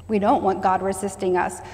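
ordinary talk, nothing marked

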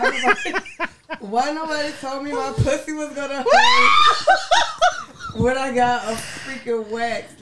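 laughing and crying at once. Real, not acted. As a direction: squeal it through laughter. Laughing through painful story.

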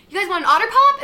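high, very loud call; mock shouted offer. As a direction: imitate someone hollering an offer to runners. Retelling runner's shouted offer.

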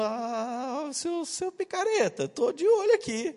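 high mock voice calling him a rascal; dad teasing his scheming son, high; teasing high squeaky voice. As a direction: tease the kid in a squeaky voice. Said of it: "Eu falo".

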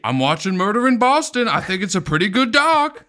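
high loud mock voice; put-on caricature voice. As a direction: keep the silly boast voice going. Mocking Boston voice bit continues.

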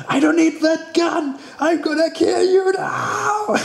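high, slow mock Austrian action-hero shout; Arnold Schwarzenegger impression. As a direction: bellow it like a hammed-up Commando villain-killer. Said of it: "Commando was good".

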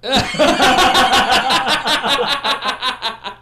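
loud open laugh; big laugh. Real, not acted. Host cracks up at cohost's joke, loud laughter. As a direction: burst out laughing at the banter.

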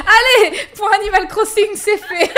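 high, loud laughing words ending in a giggle. Real, not acted. Laughing at her own joke to her co-host, loud and high. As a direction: crack up mid-sentence.

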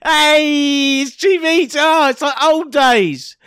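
high, loud gleeful cry; excited shout. Real, not acted. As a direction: cheer it out.

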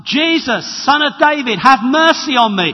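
high, loud desperate cry; crying out for mercy. Acted. Blind beggar shouting to Jesus through the crowd, a high loud plea. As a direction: shout it over the noise, pleading. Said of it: "And a voice comes".

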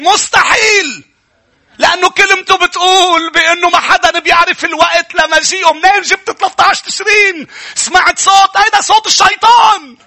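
high, loud fiery sermon voice; preaching at full force. Acted. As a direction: thunder the point at the congregation. (وعظ بحماس وبصوت عالٍ)

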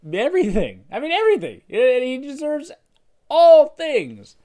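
rising to a loud, high preached cry; preaching climax. Acted. Pastor to congregation, peaking in a loud preached shout. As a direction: build the sermon to full force on everything.

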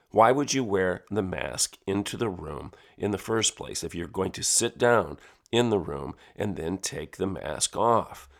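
usual speaking voice

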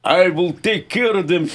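Russian character promising to take care of them; Russian accent, mock menacing; Russian tough guy. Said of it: "You can have a Russian say".

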